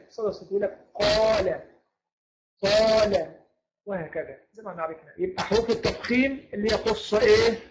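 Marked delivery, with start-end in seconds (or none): 1.0-3.3 "قال" with a full, heavy ق filling the mouth; heavy tafkhim. Shown as a demonstration. Teacher demonstrating tafkhim to students, heavy emphatic "قال". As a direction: let the ق of "قال" echo thick in the mouth. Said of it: "فيمتلئ الفم بصداه".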